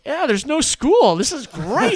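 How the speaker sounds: put-on high, loud, slow gleeful kid voice; playing his overjoyed fifth-grade self. Fifth grade [name] cheering no school, high and gleeful. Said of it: "fifth grade [name] was like".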